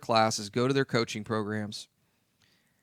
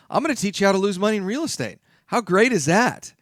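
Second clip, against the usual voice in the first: excited pitch; 7 semitones higher, 4 dB louder than usual. Real, not acted. Playful promo of own event.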